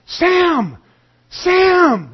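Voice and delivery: high, loud shout of a name; yelling out the window. Retold or imitated. Wife yelling for the neighbor at three in the morning, high shout. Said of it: "starts yelling".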